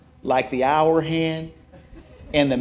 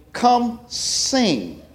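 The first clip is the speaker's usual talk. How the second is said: church folks inviting; 8 semitones higher than usual. Retold or imitated. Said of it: "They're gonna say".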